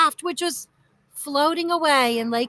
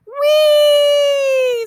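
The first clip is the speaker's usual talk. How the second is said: high, loud thrilled squeal; 13 semitones higher, 8 dB louder than usual. A narrator's reading. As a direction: squeal like a child on a ride. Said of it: "squealed Aunt Hazel".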